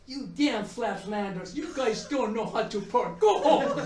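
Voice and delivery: mock fury at the flatlanders, laughing through it; angry note read aloud. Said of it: "there was a nasty note".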